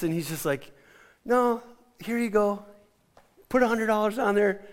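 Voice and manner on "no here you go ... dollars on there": lightly voiced gracious friend, higher. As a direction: offer it kindly, with a smile you can hear. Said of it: "he's just so gracious".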